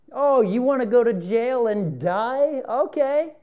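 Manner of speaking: high, slow mocking taunt; mock Peter's bravado in a high, slow sneer; sneering Satan. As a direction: taunt him, high and drawn out like a gloating devil.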